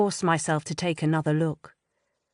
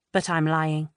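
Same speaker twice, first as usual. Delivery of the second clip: usual speaking voice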